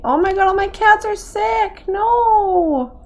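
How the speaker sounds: high, wailing cry of alarm; dismayed. Real, not acted. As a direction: cry out over the sick game cats. Game cats found sick.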